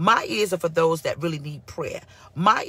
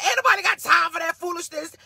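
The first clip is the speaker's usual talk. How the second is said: much higher, mock scolding mother; mother's scolding; 11 semitones higher than usual. Pastor quoting her mother, high mock scolding. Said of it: "my mother said".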